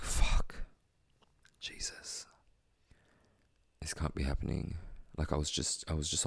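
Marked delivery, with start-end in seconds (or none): 0.0-4.8 breathless stunned whisper; a whispered curse in shock. Retold or imitated. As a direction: whisper it in disbelief. Shocked on hearing of death.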